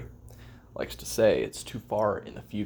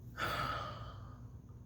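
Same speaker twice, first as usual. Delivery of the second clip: long, tired, whispery sigh; drawn-out exhausted sigh; heavy sigh; 11 dB quieter than usual. Real, not acted. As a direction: let out a long, worn-out breath. Alone, weary, after Xanax line.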